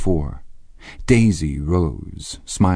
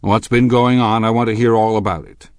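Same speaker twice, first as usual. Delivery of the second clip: raised, demanding authority; would-be paternal; 5 semitones higher, 6 dB louder than usual. A narrator's reading. Husband demands the whole story, would-be paternal. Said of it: "groped unsuccessfully for the paternal note".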